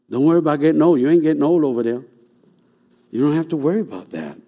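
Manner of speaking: normal talking voice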